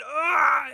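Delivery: high-pitched cry of pain. Acted. Stabbed man drops holding his side, pained cry. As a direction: cry out as the blade hits.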